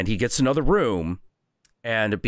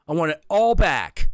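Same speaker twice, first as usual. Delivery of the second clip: higher and louder, pressing harder; louder demand; 8 semitones higher, 5 dB louder than usual. Acted. Little Viking insisting to the innkeeper, louder the second time. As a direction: repeat it with more force. Kid demanding his things.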